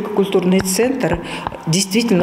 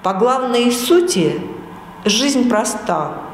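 poetry recitation. Acted. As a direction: recite with solemn pauses. Women recite poem on camera.

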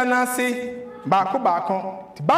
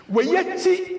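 normal talking voice

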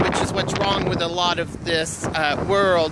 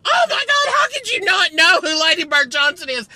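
incredulous; 8 semitones higher than usual. Real, not acted. Indignant rant at young lady.

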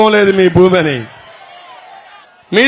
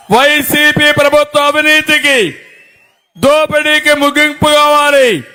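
rally speech; 4 semitones higher than usual. Real, not acted. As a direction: rouse the crowd with every line. Rally speech calling the crowd. (Rally ప్రసంగం, గట్టిగా)

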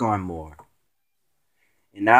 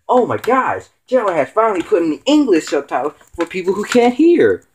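high, fast gushing fan voice; mock excited fan; 7 semitones higher, faster than usual. Imitating a fan thrilled about subtitles, high and fast.

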